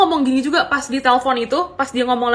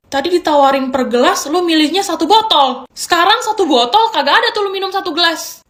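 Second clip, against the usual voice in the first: incredulous rant; 6 semitones higher, 4 dB louder than usual. Retold or imitated. Retold rant at drunk date.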